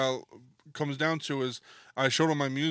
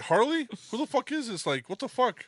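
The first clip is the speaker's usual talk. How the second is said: high, baffled, half-yelled question; confused yell; 10 semitones higher than usual. Retold or imitated. Retelling a late-night prank call, high baffled shout. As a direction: pitch it way up in disbelief. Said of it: "I was like".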